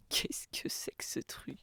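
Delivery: slow baffled whisper. Real, not acted. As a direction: whisper it in disbelief. Baffled by an ugly box.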